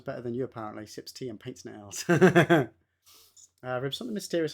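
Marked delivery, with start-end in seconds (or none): 2.1-2.7 loud quick laugh; short burst of laughter. Real, not acted. Reacting to chat.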